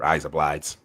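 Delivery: lightly put-on Australian accent, slowed down. Shown as a demonstration. Asked to do an Australian accent, mock Australian. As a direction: try a slow Australian accent. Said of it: "Can you do an Australian accent?".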